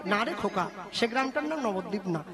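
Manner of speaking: gentle elder. Acted. Villager answers the boy.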